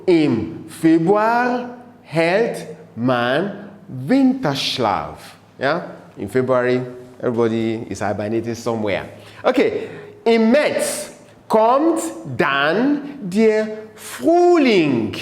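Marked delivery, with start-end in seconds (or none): 0.1-5.4 drawn-out German, word by word; slow, careful recitation for repeating; slow model reading. Shown as a demonstration. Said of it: "you read it after me". 10.2-15.2 slow, lifted, spaced-out German words; teacher dictating a poem line; slow model reading. Shown as a demonstration. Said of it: "you read it after me".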